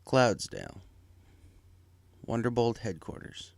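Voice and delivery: usual speaking voice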